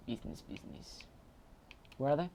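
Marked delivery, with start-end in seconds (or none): 0.1-1.0 whisper. Pretend. Repeating the game line.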